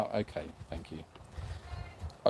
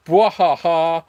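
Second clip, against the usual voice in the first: mock evil laugh; 7 dB louder, slower than usual. Reads chat, mock villain laugh.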